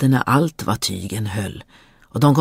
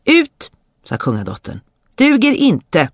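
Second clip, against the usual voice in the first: curt command; 8 semitones higher than usual. Acted. Princess ordering the suitor out, sharp. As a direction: throw him out with one word.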